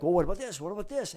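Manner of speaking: high, pressing repeated questions; client getting loud. Acted. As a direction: pile on as a loud, demanding client. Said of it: "the client might be getting loud with them".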